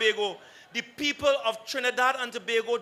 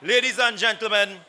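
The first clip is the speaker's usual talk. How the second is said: formal, building speaker introduction; announcer introduction; 4 semitones lower, 7 dB louder than usual. Real, not acted.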